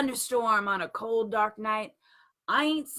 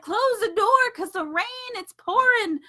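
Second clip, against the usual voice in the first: loud, high urgent call; Noah crying out; 10 semitones higher, 7 dB louder than usual. A narrator's reading. Noah yelling to close the ark door, loud and high. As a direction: shout it over the storm. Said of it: "Noah cried".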